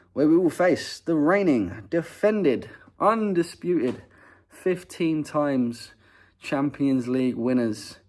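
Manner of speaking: put-on slow, grand Spanish-accented announcer build-up; mock Spanish accent, drawn out and grand. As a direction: roll it out like a Spanish commentator hyping Real Madrid. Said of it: "I said it in a Spanish accent".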